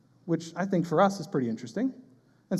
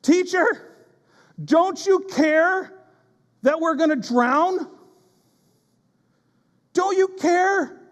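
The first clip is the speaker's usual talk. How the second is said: desperate shout; 9 semitones higher, slower than usual. Retold or imitated. Disciples wake the sleeping Jesus, desperate shout. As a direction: cry out to the teacher, stretching each word. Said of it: "The disciples wake him up shouting".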